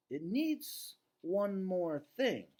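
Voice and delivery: Ivan the gorilla. Acted. Ivan tells Bob the picture lacks something, slow and deliberate. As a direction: draw each word out thoughtfully.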